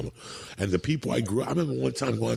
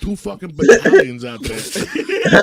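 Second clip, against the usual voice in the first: mock outraged firemen; 11 semitones higher, 10 dB louder than usual. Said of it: "They're like".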